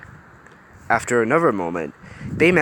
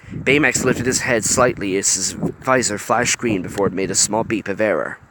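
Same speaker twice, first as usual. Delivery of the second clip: ordinary delivery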